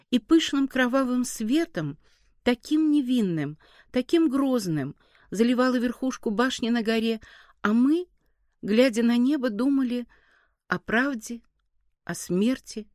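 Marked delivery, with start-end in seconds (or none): none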